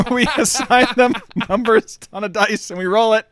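talking through laughter; laughing. Real, not acted. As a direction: laugh while explaining. Laughing while explaining.

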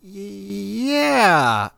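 sheepish yeah. Retold or imitated. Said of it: "And I was like".

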